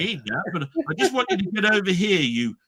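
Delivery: high, fussy, apologetic mock posh voice; prim non-swearer. Timid sitcom son trying to insult someone, high prissy. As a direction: mince it like a polite man who can't swear. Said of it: "He'd be turning around saying".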